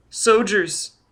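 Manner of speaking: put-on high little-boy voice; child answering. A narrator's reading. Child tells Foyle who is above, high small voice. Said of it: "the child answered".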